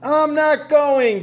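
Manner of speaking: high, loud, slow refusal; slow high defiant cry. A narrator's reading. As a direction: dig in like a defiant kid. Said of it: "cried Froggy".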